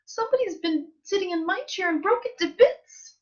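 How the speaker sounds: very high little cub's complaint; wee little Baby Bear voice. A narrator's reading. Baby Bear finds his chair broken, wee little voice. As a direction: wail it lightly as a tiny bear. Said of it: "in his wee little voice".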